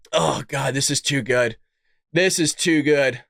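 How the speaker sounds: low, drained delight; deep, satisfied groan of enjoyment; savouring it. Real, not acted. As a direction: sink down low, spent from laughing. Savouring the news after laughing.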